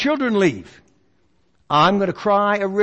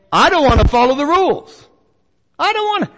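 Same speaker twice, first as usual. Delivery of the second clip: petulant refusal, pushed louder and rising; defiant rule breaker; 5 semitones higher, 5 dB louder than usual. Retold or imitated. Preacher voices a churchgoer refusing the rules, defiantly. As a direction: play the sinner who won't obey.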